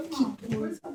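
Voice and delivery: ordinary delivery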